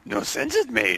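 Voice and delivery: high cartoon squeak; squeaky silly voice.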